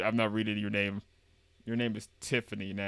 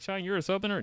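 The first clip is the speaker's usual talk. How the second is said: much higher questioning voice; mock surprised question; 9 semitones higher, faster than usual. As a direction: put on an incredulous listener. Imagined question from someone else.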